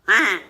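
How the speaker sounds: loud low duck call honk. Shown as a demonstration. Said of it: "the regular call is".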